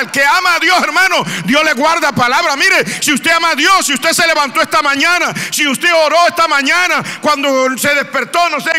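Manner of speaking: high, loud preaching shout that keeps climbing; fiery preaching. Acted. Pastor preaching to his congregation, high, loud, fervent shouting. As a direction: preach it full force to the congregation.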